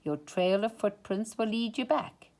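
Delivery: plain reading voice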